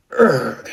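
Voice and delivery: loud monster groan; beast groan. A narrator's reading. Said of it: "as it groans".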